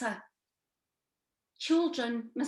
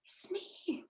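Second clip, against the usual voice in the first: whisper; 6 semitones higher, 12 dB quieter than usual. Acted. Whispered echo of self.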